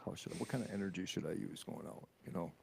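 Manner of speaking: hushed and low, unsure how to sign off; low quiet voice. Real, not acted. Host unsure how to close the show, low hushed voice. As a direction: drop to a low near-whisper. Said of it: "that's kinda why I'm talking like this right now".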